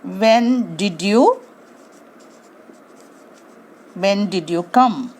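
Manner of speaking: slow, careful correct question. Shown as a demonstration. Teacher models the correct question slowly. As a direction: say each word slowly. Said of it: "how we can ask this".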